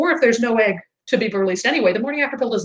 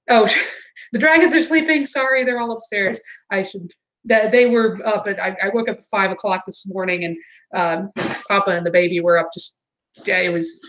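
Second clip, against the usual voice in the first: ordinary delivery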